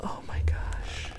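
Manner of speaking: hushed, slow whisper; breathless storytime whisper. Acted. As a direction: whisper as if the monster might hear. Reader reacts in suspense.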